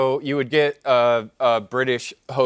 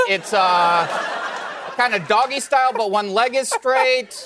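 folksy audiobook narrator; 6 semitones higher than usual. Acted. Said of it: "I think it would go like this".

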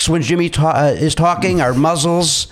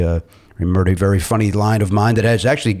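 usual speaking voice

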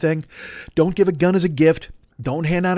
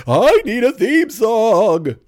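high, drawn-out hammed-up holler; spring it on him with a long, loud wail; exaggerated pestering shout; mock demanding yell; 8 semitones higher, 5 dB louder, much slower than usual. Acts sneaking up demanding song.

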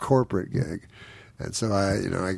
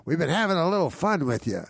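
mock jovial organiser; 8 semitones higher than usual. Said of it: "he goes".

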